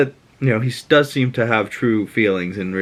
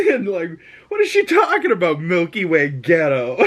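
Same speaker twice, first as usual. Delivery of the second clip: high, laughing bafflement; amused disbelief; 7 semitones higher than usual. Real, not acted. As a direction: question it incredulously through real laughter. Baffled reaction between laughs.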